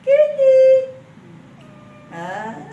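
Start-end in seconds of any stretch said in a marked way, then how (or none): 0.0-0.6 high, loud squealed call; shrill-pitched summons. Real, not acted. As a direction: call the pet in a piercing high voice. Calling the pet by name.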